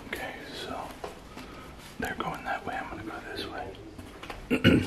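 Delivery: tense whisper; whisper, afraid to be heard. Real, not acted. Splitting up in dark house.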